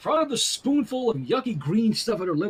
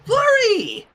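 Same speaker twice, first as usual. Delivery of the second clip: calling out; 6 dB louder than usual. A narrator's reading. Joyful call to toddler.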